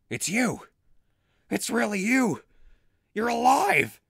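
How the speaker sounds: rising joy at finding him; finding a friend thought dead, building to excitement; excited disbelief. Acted. Finds lost friend.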